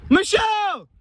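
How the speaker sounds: loud call to test the echo; shouting. Real, not acted. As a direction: yell the name out across the water. Yelling to hear the echo.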